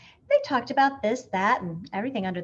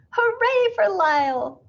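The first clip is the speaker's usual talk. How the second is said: high, loud slow cheer; shouted cheer; 11 semitones higher, 7 dB louder, slower than usual. A narrator's reading. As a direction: cheer the hero. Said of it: "the crowd shouted".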